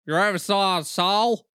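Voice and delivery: mock American accent. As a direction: drag out a teasing American accent. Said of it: "just my good American accent".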